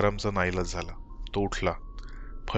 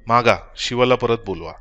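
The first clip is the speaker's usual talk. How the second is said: much louder urgent demand; passionate; 2 semitones higher, 8 dB louder than usual. Acted. Wife passionately urges her husband to wish, loud. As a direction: throw it at him with fervour. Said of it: "ती आवेशाने म्हणाली".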